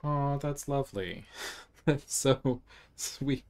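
slow, laughing, touched aww. Real, not acted. Player melts at a sweet in-game scene, laughing slowly. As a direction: melt at the cute moment.

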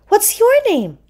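high-pitched seahorse question; friendly seahorse. A narrator's reading. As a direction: ask sweetly in a high little voice. Seahorse asks Puffy his name.

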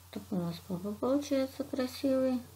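ordinary talk

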